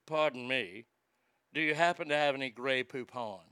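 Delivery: mocking a posh gent asking for mustard. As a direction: put on the Grey Poupon snob. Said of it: "make you sound more posh".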